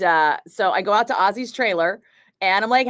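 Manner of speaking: ordinary delivery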